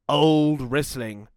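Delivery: usual speaking voice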